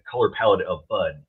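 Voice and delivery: usual speaking voice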